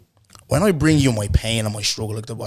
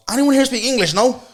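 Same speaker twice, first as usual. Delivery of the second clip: put-on high, loud voice of a girl asking; mimicked high-pitched female query; imitated girl traveller; 13 semitones higher, 6 dB louder than usual. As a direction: pitch it way up as her, calling out. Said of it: "She goes".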